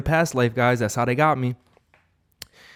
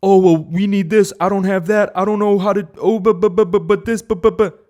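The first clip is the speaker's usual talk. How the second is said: high, louder whining, stammering buts; mock whiny excuses; 9 semitones higher, 7 dB louder than usual. Mocking weak people who can't figure it out, high whiny stammer. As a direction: whine like someone full of excuses.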